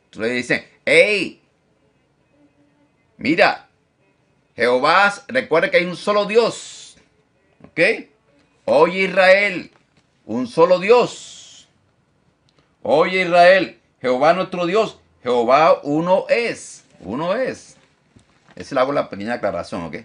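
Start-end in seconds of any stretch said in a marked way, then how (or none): 0.8-7.0 loud, high proclamation; preaching at full force. Acted. Preacher voicing the call to Israel, loud and raised. 8.6-17.8 loud, high proclamation; preaching at full force. Acted. Preacher voicing the call to Israel, loud and raised.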